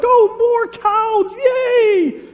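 put-on drawn-out, very high, loud childish cheer; excited grandkid. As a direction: cheer at full volume like an overjoyed little kid. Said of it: "they're so excited to see this cow".

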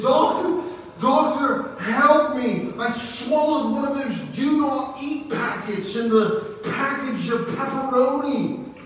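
high, loud mock panic; panicked pleading. Patient rushing to his doctor for help, hammed-up panic. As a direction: plead frantically, hammed up for a joke. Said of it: "pleading to get in to see him".

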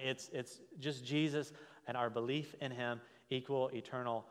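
usual speaking voice

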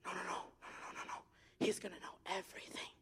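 frantic hushed no's, whispered; panicked whisper. Retold or imitated. The frightened student, whispering her panicked refusal. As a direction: whisper it like a terrified teen.

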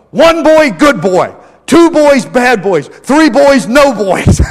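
half-playful angry grandpa scolding; mock angry grandpa. Grandpa scolding his rowdy grandsons, loud mock anger. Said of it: "He would get spitting mad at us".

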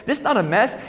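put-on small boy insisting back at his dad; a hint of a cute child's voice; cute five-year-old. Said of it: "in a cute little five-year-old voice".

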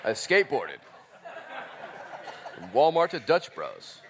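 laughing, mock-smug boast; mock bragging. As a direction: send up a bragger. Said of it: "Nobody's gonna be like".

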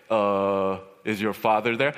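low-pitched imitation of a doubtful adult caller; creditor on phone. Creditor asks child for the father, in a low voice. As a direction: drop the voice low for the grown man. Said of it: "the guy's like".

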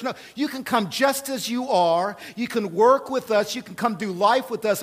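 high-energy pulpit voice; impassioned preaching. Real, not acted. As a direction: preach it with rising urgency, rolling from phrase to phrase. Preaches at full force.

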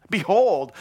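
loud, high cry of wonder; John's awestruck "behold"; awed exclamation. Acted. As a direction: call it out amazed. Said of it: "he just says".

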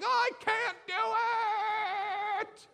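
put-on high wailing kid's cry; child wailing. Frustrated child on the floor, high crying wail. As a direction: sob it out like a frustrated toddler. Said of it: "screaming on the floor, crying".

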